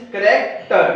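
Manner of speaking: character said very slowly; slow careful word. Shown as a demonstration. Teacher slowly modelling the last word, character. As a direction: draw out each syllable of character. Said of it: "stress कहाँ पे आ रहा है".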